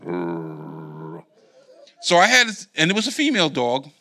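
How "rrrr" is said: deep, low imitated dog growl; rumbling mock growl of a dog. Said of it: "the dog went".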